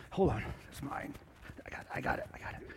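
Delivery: hushed whisper off to the side; soft, breathy aside. Real, not acted. Aside to helper while props slip.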